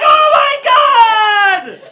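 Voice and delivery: high, loud shriek, drawn out; screaming. Retold or imitated. After the turd touches his lip, screaming. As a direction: scream in disgust. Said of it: "I screamed".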